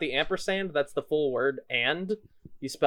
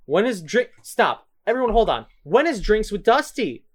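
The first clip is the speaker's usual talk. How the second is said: higher and louder, eager interruption; excited; 7 semitones higher, 6 dB louder than usual. Real, not acted. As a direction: stop everyone, you just remembered. Excitedly cutting everyone off.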